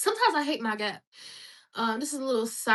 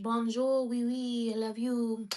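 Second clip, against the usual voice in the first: mock French; slower than usual. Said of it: "Speak French".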